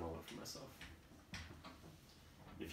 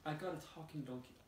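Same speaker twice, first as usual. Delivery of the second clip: lightly voiced old woman; 7 semitones higher than usual. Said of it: "The old woman says".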